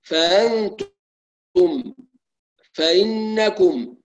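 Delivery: فأنتم drawn slow with crisp glottal stop; slow clear hamza. Shown as a demonstration. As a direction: recite slowly, hamza distinct. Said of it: "زي ما نقرأ". (فأنتم بتحقيق الهمزة)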